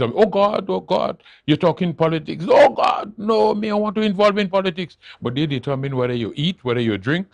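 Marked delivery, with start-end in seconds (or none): 0.0-5.0 high, loud mock wail of horror; alarmed quote. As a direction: imitate people recoiling, high-pitched and loud. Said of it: "Because they think, it's".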